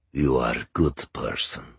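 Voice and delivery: put-on low Ukrainian-accented father; heavy, drink-thickened voice; thick-accented older man. Voices Mister Pavlikovsky.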